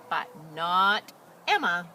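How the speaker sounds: slow, loud, weighty but not Emma; dramatic emphasis. A narrator's reading. Reading to children, slow loud dramatic beat. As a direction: land it slowly for suspense.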